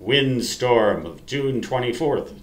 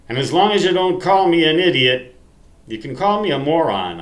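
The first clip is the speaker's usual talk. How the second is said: playful outburst; 6 semitones higher, 7 dB louder, faster than usual. Real, not acted. Joking about comment rules.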